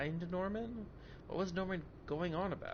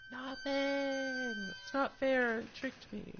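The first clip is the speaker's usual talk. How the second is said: put-on much higher bratty kid voice; childish whine; 10 semitones higher, much slower than usual. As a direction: sulk like a cheated child. Childish complaint about game.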